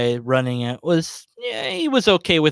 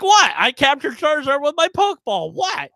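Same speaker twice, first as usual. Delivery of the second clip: mock disbelief; 12 semitones higher than usual. Player feigning innocence to the DM, high incredulous disbelief. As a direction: play the innocent, too high and wide-eyed.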